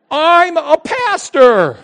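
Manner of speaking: high, loud, very slow declaration; adamant. Retold or imitated. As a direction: plant each word firmly.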